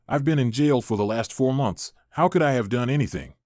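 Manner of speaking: ordinary delivery, nothing marked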